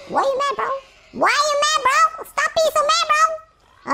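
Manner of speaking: mocking the robbed player for being mad; taunting. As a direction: needle the angry player.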